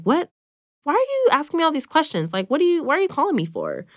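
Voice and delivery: alarmed. Retold or imitated. Retold confused call with examiner.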